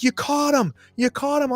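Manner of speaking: very high-pitched excited cries; excited crowd. Acted. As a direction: mimic thrilled campmates way up high. Camp greets the found friend.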